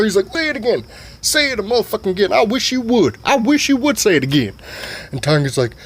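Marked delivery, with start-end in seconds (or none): 0.3-4.5 hammed-up high daddy voice; father voice. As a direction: play the pushy old dad, pitched way up. Dad goading.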